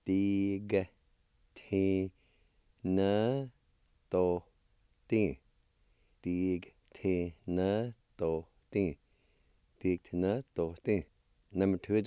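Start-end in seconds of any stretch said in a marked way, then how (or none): 0.1-5.4 slow, each syllable held apart; teacher's careful syllable-by-syllable model. Shown as a demonstration. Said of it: "It's pronounced like this". 6.2-9.0 separated syllables at a measured pace; careful teaching demo, syllables apart. Shown as a demonstration. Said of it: "It's pronounced like this".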